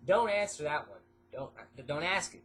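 ordinary delivery

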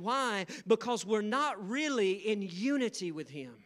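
fervent preaching, high and loud. Real, not acted. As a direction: proclaim it to the congregation with force.

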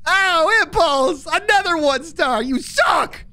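high, loud heckle; loud jeer. Real, not acted. Streamer jeering at teammate over another one-star rating, loud heckle.

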